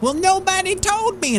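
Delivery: mock protest. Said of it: "I was like".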